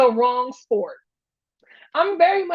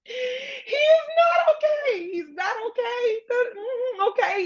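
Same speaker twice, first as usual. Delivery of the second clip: laughing; 12 semitones higher, slower than usual. Real, not acted. Laughing disbelief at the fight.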